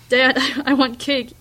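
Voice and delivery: loud laughing call from outside; child's yell. Acted. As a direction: yell for cake like an excited girl. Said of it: "Rose yells from outside".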